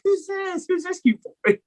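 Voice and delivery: very high cooing voice for a dog; speaking to a dog in high baby talk. Retold or imitated. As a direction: coo at the cute puppy. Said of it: "every time I see a dog".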